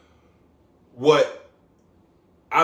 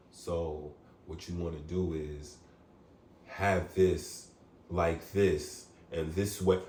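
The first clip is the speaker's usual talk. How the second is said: much lower and softer, slow instructional drone; mock tutorial voice; 11 semitones lower, 10 dB quieter, slower than usual. Mocking scripted barber tutorial videos, in a low soft drone. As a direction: parody a hushed, stiff barber tutorial narrator. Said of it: "don't nobody fucking talk like that".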